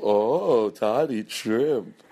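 slow, drawn-out mock gossip; lightly voiced sneering crew; mocking podcast crew. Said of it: "the fake podcast crew would go".

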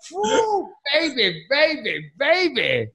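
high excited whoops; hyped woo baby. Pretend. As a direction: cheer it up high. Mock hair shaking bit.

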